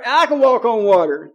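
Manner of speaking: loud mock boast; braggadocious. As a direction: imitate a cocky hothead bragging.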